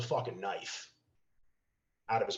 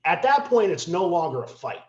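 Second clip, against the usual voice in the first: ordinary talk, nothing marked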